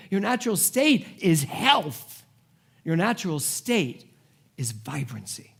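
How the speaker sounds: rising sermon declarations; preaching. Acted. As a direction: preach it like a litany. Preaching repeated affirmations.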